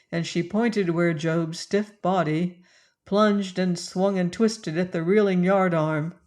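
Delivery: plain reading voice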